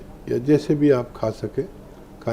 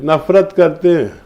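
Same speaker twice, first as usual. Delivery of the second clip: usual speaking voice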